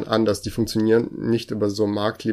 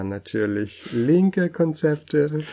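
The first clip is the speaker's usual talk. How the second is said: chipper 1950s ad; 5 semitones higher than usual. Pretend. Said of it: "Kaufen Sie jetzt!".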